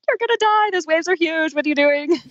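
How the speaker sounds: high, alarmed inner voice; hammed-up high-pitched alarm; panicking shoulder devil. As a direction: warn her in a mock panic. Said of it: "then on the other shoulder".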